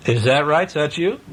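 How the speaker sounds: plain reading voice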